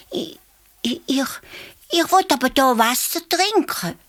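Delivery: high stammering little voice; timid baby elephant. A narrator's reading. Baby elephant answers the hare timidly. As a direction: stutter shyly like a scared child. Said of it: "sagt das Elefantli".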